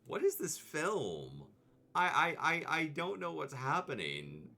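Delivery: a lightly voiced confused viewer. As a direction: play the bewildered viewer. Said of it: "everyone's like".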